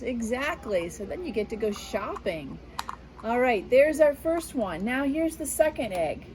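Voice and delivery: usual speaking voice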